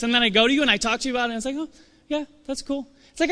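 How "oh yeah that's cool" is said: a high, soft, indifferent huh yeah that's cool; unimpressed. Retold or imitated. Friend shrugging off your big news, indifferent. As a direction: brush it off like it's nothing.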